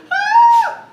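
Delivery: hammed-up kids' shriek, very high and loud; mock scared shriek. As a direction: imitate the campers squealing as they cover their ears. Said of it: "hiding their ears and going".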